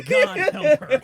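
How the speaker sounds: loud, high laughing plea. Real, not acted. Friend joking about the fiance, laughing loudly. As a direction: cry it out through laughter.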